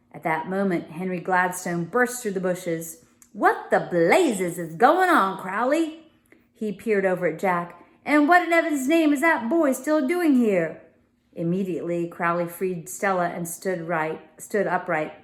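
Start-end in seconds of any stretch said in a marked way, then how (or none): 3.3-10.8 demanding father. A narrator's reading. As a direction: burst in and demand to know. Father bursts in demanding answers.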